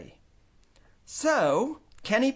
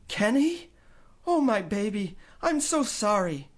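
higher, tearful mother; 7 semitones higher than usual. Acted. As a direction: apologise through tears. Mother crying.

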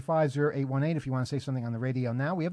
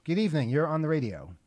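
ordinary talk, nothing marked

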